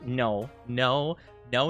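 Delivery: normal talking voice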